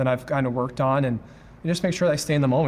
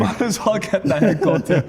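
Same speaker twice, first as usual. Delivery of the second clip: laughing; 6 semitones higher, 6 dB louder than usual. Real, not acted. Laughing in the ice bath.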